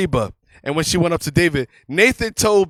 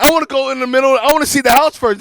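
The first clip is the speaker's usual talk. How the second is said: impatient demand; 7 semitones higher, 4 dB louder, faster than usual. Retold or imitated. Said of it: "I'm like".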